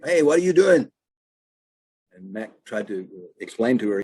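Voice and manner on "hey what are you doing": mock yell, higher and louder; yelled at him. Lady on porch yells at trespasser, mock shout. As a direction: call out sharply at a stranger in the yard. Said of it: "stood up and yelled at him".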